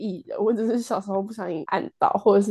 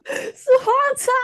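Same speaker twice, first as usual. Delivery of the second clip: squeaky, pitched way up; high squeaky voice. Pretend. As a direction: answer in a high, whiny squeak. Reciting her old joke answer.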